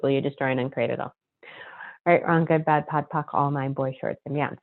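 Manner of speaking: fast, soft, murmured recitation; rapid clearing statement. Acted. Host running the clearing for listeners, a fast, soft, murmured rote recitation. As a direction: rattle the formula off under your breath.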